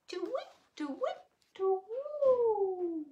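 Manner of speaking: high sing-song owlet hoots; owlet call. Acted. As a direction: chirp it like baby owls. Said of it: "flap their little wings and go".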